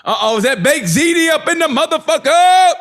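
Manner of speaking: high, rising hype shout; mock-excited call. Friend hyping Baked Ziti walking into the house, mock-excited. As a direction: call out the arrival, climbing high to the end.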